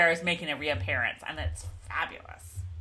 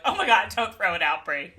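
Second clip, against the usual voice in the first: half-laughing, louder plea; 7 dB louder than usual. Retold or imitated. Host reads her own note urging Brie not to toss it. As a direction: beg through a laugh. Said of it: "I'm like".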